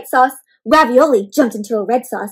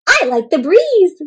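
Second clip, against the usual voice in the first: Shumai's voice; 6 semitones higher, 5 dB louder than usual. Acted. Shumai character voice in story.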